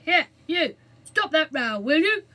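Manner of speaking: loud firm guard's shout. A narrator's reading. Guard outside the cell door, firm loud shout. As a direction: bellow down the passage. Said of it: "a firm voice shouted".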